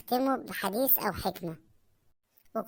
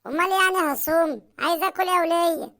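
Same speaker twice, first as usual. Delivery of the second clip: higher and louder, indignant demand; loud protest; 5 semitones higher, 6 dB louder than usual. Acted. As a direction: insist you are starving, loudly. Husband protests loudly.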